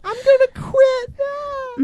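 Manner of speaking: very high, slow wail; hammed-up sobbing threat; mock crying wife. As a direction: imitate a tearful, drawn-out screech. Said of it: "blah, blah, and cry".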